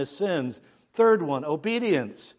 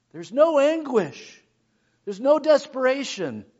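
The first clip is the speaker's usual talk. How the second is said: impassioned preaching; 8 semitones higher, 5 dB louder than usual. Acted. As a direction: plead over the missing anguish. Preaching lament.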